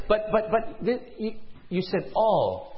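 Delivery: halting, disbelieving questioning; stammering protest. Acted. Parent questions the doctor about the form, stammering. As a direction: stumble over the words in dismay. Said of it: "you question the doctor".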